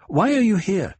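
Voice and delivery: high-pitched, agitated demand; startled question. A narrator's reading. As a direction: ask in sudden confusion, pitch jumping. Confused patient questions unexpected visitor.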